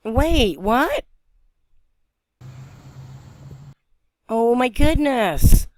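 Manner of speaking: high, rising disbelief into a drawn-out exclamation; stunned, slowing on the exclamation; astonished. Real, not acted. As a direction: react in real amazement, pitch jumping up. Spots something unexpected in the sky.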